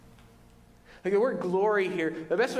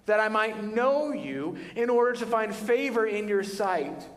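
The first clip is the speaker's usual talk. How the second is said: lightly voiced pleading prayer, a little higher; Moses praying; 6 semitones higher than usual. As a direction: ask God slowly, hands open. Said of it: "Some of your versions say".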